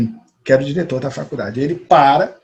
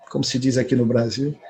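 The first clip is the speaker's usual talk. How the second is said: usual speaking voice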